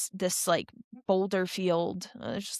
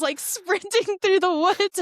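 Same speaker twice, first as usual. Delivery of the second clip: laughing; 11 semitones higher, 4 dB louder than usual. Real, not acted. Laughing at her own slow pace.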